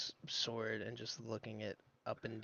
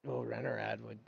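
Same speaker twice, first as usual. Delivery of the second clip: ordinary talk, nothing marked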